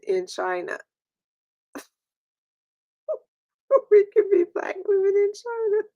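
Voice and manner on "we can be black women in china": high, breaking with laughter; giggling while repeating the joke; laughing through it. Real, not acted. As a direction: squeak it out mid-laugh.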